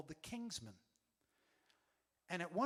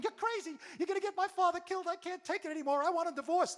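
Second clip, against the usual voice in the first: high, loud, fast hysterical outburst; frantic shouting; 14 semitones higher, 8 dB louder, faster than usual. Retold or imitated. Mother runs out at the commotion, shouting frantically. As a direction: shout it at a high pitch, at the end of your rope. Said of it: "comes running out of the back room shouting".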